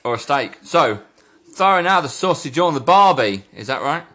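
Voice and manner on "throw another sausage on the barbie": put-on Australian accent on a catchphrase. Host revising the cliché for the guest, mock Australian accent. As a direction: try the stock Aussie accent. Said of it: "you didn't sound too convinced".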